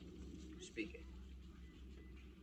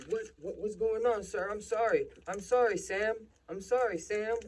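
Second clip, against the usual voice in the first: high-pitched, louder flustered apology, acted out; scared, apologetic dad; 10 semitones higher, 5 dB louder than usual. Dad apologizes to the cop, flustered and pleading. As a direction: imitate a panicked dad apologizing to police. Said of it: "He's scared as fuck".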